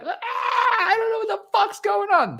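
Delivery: high, loud confused shout; bewildered scream. Retold or imitated. Fan mimicking bewildered crowd's high screaming. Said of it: "they're just screaming".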